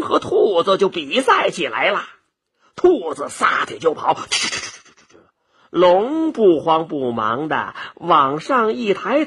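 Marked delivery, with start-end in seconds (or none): 4.1-5.2 soft voiceless rapid-fire puffs; imitated scampering, all breath; whooshing running noise. Storyteller races the rabbit away, whispered whooshes.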